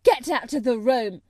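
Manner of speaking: loud, enraged order; angry shout. A narrator's reading. Colin in a rage ordering Mary out, shouting. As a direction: shout them out furiously. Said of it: "he shouted".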